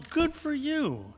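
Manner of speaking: ordinary delivery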